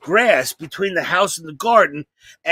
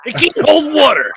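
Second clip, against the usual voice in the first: loud, higher incredulous rant; mock outrage; 8 semitones higher, 5 dB louder than usual. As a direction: shout like a betrayed kid.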